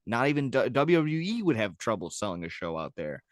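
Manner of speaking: normal talking voice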